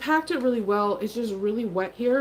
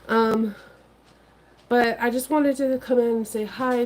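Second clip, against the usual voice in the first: ordinary talk, nothing marked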